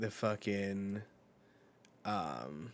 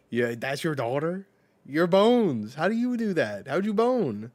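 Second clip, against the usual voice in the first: high, loud mock outrage; higher and louder with each question; incredulous; 7 semitones higher, 10 dB louder than usual. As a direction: yell it in mock disbelief. Mocking outraged retold character.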